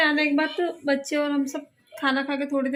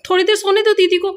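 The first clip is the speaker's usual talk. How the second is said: scolding; 5 semitones higher, 5 dB louder than usual. Real, not acted. Mother scolds son for waking sister.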